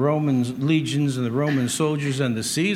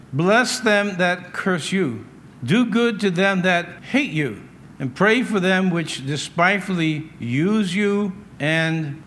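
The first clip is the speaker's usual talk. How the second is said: solemn scripture reading; 3 semitones higher, slower than usual. Acted. Pastor reading Jesus' words to the congregation, slow and solemn. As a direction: let each line of scripture land slowly.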